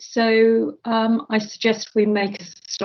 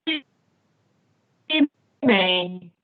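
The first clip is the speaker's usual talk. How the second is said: breaking up; 2 semitones lower than usual. Real, not acted. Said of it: "you're breaking up, Chair".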